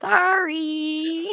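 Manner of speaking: "Sorry" lisped as "thorry", high and drawn out; sloth's lisp. Acted. Co-host doing the sloth on request, "Sorry" lisped high. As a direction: stretch "Sorry" with a th for the s. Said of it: "Say the sloth".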